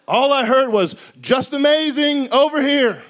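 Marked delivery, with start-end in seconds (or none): 1.2-3.0 loud, drawn-out call across the water; guide calling out. Retold or imitated. Snorkel guide calling tourists over, loud and slow. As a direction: hail the swimmers from far off.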